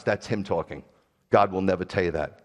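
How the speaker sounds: ordinary talk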